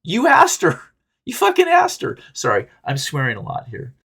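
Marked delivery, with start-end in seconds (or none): 0.0-2.2 high, louder mock yell at yourself; a hammed-up outburst at your own foolishness; mock self-scolding yell. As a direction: scold yourself, much higher. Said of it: "And then you're like".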